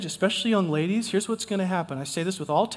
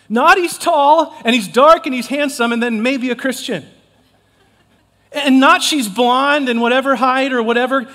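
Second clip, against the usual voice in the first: louder, higher preaching push; loud, high preacher exhortation; fervent preaching; 6 semitones higher, 8 dB louder than usual. Real, not acted. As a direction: exhort the crowd with rising heat. Preacher rising in fervour.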